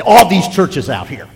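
loud, drawn-out mock complaint; mock objector. Churchgoer dodging the real issues, loudly mock-complaining. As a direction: push it high and loud, imitating a deflector. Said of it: "When you confront somebody about the real issues, they'll say".

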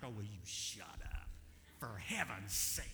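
whispered, slow annoyed grumble; annoyed brother. Retold or imitated. Brother to tattling sister, slow exasperated whisper. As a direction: hiss it at her under your breath. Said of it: "I'm like".

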